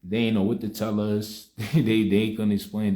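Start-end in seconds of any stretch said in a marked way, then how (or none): 1.6-2.9 laughing. Real, not acted. Laughs recalling staff confusion.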